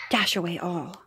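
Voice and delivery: ordinary delivery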